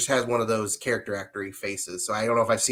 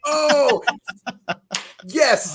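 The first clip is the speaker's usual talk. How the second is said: laughing; 11 semitones higher, 5 dB louder than usual. Real, not acted. Reacting to the funny story.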